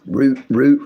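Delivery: route pronounced ROOT, twice. Shown as a demonstration. Offering both ways to say the word, route said as ROOT. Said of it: "whatever you wanna say it".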